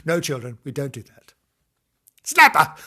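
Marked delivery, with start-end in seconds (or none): 2.2-2.8 high, loud comic yell; mock baying shout. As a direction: burst out loudly as a joke. Mock urging, repeated after telling off.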